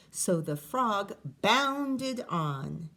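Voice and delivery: ordinary delivery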